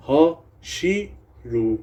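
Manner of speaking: Hashiru said slowly, syllable by syllable; slow careful retry. Shown as a demonstration. Teacher slowly corrects his own mispronounced word. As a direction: carefully sound out Hashiru after a slip. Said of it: "Haji, what the fuck?".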